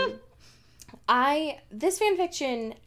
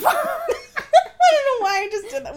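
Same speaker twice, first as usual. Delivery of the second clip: higher, louder, helpless laughter through words; embarrassed at yourself and losing it laughing; 7 dB louder than usual. Real, not acted. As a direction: crack up while talking. Cracking up at own sign-off.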